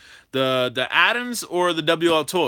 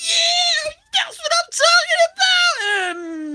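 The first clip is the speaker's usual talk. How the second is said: very high, slow squealing cheer; mock celebration; 4 dB louder, much slower than usual. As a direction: ham up his own excitement. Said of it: "And I'm like".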